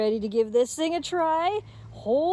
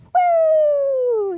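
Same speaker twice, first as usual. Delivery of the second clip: big high whoop, much louder; excited whoop; 9 dB louder than usual. Real, not acted. Owner cheering as the dog runs after the ball, loud whoop.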